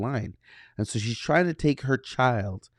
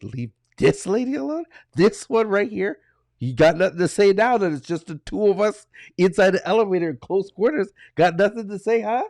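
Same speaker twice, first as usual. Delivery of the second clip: high, pointed taunting, a bit louder; furious taunt; 8 semitones higher, 3 dB louder than usual. Retold or imitated. Father mocks the rude lady in the elevator, higher-pitched taunt. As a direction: needle her in a higher voice. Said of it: "But I was furious".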